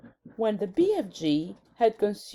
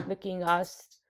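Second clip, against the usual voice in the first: usual speaking voice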